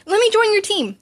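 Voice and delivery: high, loud and bursting with eagerness; eager. A narrator's reading. Support girl rushing up to Izuku, loud and eager. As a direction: barge in grinning.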